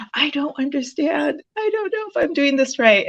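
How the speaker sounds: high, worried voice of someone lost; anxious and confused. Retold or imitated. Unsure about the program, asking the coach in a high anxious voice. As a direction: sound panicked that you're doing it wrong. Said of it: "I'm like".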